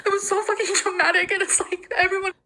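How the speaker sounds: high, tearful, breaking voice; crying while talking. Real, not acted. Crying over cancer rumors.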